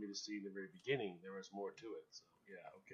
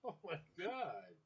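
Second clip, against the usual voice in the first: laughing; 7 semitones higher, slower than usual. Real, not acted. Shocked laughing at result.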